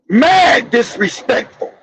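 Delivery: ordinary talk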